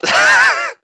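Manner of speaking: laugh. Real, not acted. Laughing at Noah's face.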